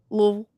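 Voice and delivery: "Louisville" squeezed into LUVL; one syllable. Shown as a demonstration. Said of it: "like, one syllable".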